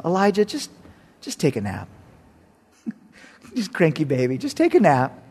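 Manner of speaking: soothing voice. Pretend. God soothing cranky Elijah.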